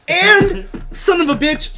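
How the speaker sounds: frustrated outburst. Real, not acted. Sudden frustrated outburst mid-sentence.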